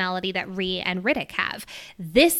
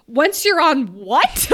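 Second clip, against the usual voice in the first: slow, louder baffled question; confused question; 4 semitones higher, 5 dB louder, slower than usual. Retold or imitated. Riddick asking Violet, slow and baffled, louder. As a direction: drag out the confused what. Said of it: "he's like".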